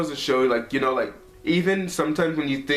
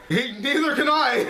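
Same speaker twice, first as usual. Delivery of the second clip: much higher, squealed through laughter; laughing exclamation; 13 semitones higher, 3 dB louder than usual. Retold or imitated. Son joking back to surprised dad, high laughing voice. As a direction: burst out laughing on a retold reply.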